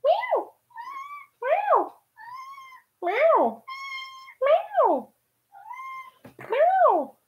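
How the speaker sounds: high, drawn-out meows that fall away; cat meow. Pretend. Meowing high at her cat who came back.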